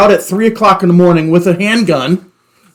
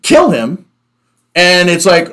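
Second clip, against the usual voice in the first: ordinary talk, nothing marked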